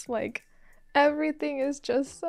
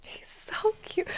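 soft unvoiced gushing whisper; 5 semitones higher, 5 dB quieter than usual. Real, not acted. Viewer whispering to herself over a cute boy, smitten. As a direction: breathe it out, smitten.

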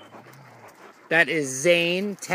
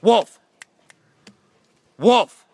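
high, very loud call; shouting; 8 semitones higher, 13 dB louder than usual. Real, not acted. Owner warning Wolf to stop, shouting. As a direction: shout the dog's name as a command.

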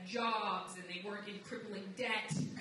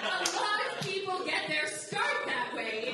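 mock reassurance; 7 semitones higher, 5 dB louder, slower than usual. Ironic consoling to audience.

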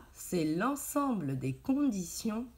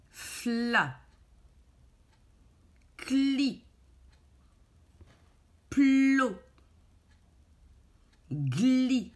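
each syllable said higher and louder on its own; clear model syllables; 4 semitones higher, 6 dB louder, much slower than usual. Shown as a demonstration. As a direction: sound out each syllable for the pupil to repeat. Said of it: "Tu répètes après moi". (syllabes détachées, bien articulées, plus fortes)

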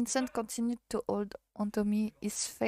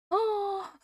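surprised oh; 12 semitones higher than usual. Real, not acted. Reader reacts to teacher's outburst.

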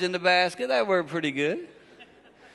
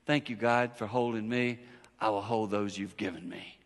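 soft thankful prayer, fading lower; quiet grateful prayer; 6 semitones lower, 7 dB quieter than usual. Pretend. Parent thanking God for holding them, softly. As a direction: pray it gently, full of thanks.

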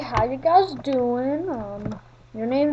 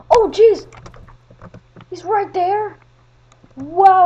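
higher, louder surprised yelp; startled shout; 7 semitones higher, 8 dB louder than usual. Real, not acted. Kid startled by a player who is suddenly there. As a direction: jump at the sight and call out.

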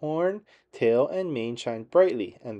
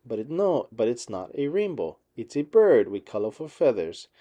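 usual speaking voice

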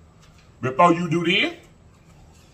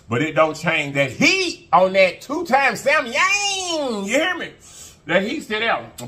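excited; 8 semitones higher, slower than usual. Real, not acted. Emphatic exclaim about heat.

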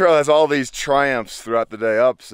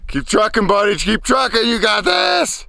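self-cheering; 9 semitones higher, 4 dB louder than usual. Real, not acted. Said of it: "cheering himself on".

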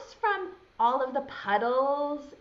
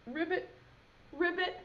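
frog ribbit; 6 semitones higher, 6 dB quieter than usual. Acted. Acting out a frog.

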